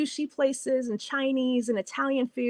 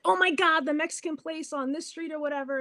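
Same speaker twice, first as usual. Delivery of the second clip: delighted gush; 7 semitones higher than usual. Retold or imitated. Delighted reply to customer.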